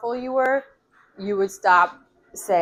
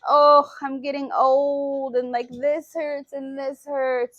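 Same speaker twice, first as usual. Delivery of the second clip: higher, slow whiny complaint; mock complaining; 6 semitones higher, 4 dB louder, slower than usual. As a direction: drag out the aches like a moaner. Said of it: "having conversations with people like".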